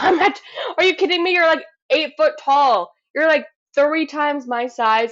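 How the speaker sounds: high, fast, hammed-up disbelief; mock panicked protest. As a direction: play up the panic at someone huge. Imagined reaction to giant roommate.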